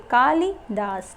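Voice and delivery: Kalidas spelled out slowly, syllable by syllable; drawn out Kalidas, each syllable clear; slow demo. Shown as a demonstration. Teacher slowly sounding out Kalidas for students. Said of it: "Kalidas में जो ला है".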